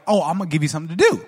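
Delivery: imitating mom. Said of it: "my mom would be like".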